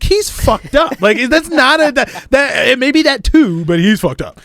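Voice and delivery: high, loud insistence; insisting loudly. Retold or imitated. Arguing with the manager that the comic is drunk, loud and high. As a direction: insist he's drunk, exasperated.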